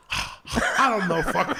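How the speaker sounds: slow, high, laughing through it; barely getting it out; mock grandma through laughter. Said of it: "And she's like".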